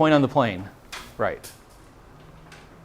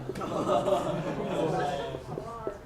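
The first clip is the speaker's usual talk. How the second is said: soft laugh; 3 semitones higher, 10 dB quieter than usual. Real, not acted. Laughs at rushing the lesson.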